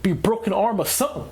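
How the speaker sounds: irritated driver stuck in traffic; exasperated driver. Retold or imitated. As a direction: act fed up with the stalled drivers. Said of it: "I'm like".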